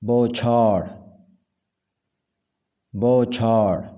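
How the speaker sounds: بوچھاڑ with an open first vowel: bau-chhaar; correct pronunciation. Shown as a demonstration. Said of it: "صحیح تلفظ ہے". (بوچھاڑ کا صحیح تلفظ، "بَو" کھلی آواز میں)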